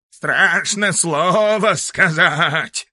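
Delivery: slow, bleating ram voice. Acted. The ram's head bleats the words back. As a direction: stretch each word like a bleat. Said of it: "заблеяла баранья голова".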